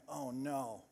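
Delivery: low, soft dread; dismayed crowd. Acted. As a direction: drop the voice in dismay. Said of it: "everybody in the crowd went".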